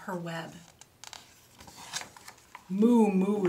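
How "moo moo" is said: loud, drawn-out mooing; cow's moo. A narrator's reading. Storyteller voices a cow for children, loud moo. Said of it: "said the cow".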